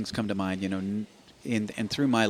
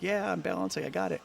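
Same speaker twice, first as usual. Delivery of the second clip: put-on much higher, thrilled little-kid voice; excited kid; 10 semitones higher than usual. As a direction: replay the joy of first balancing. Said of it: "it's like".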